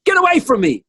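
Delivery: high, loud mock shriek of a flattered lady. The lady in red playfully shooing away a compliment, high and loud.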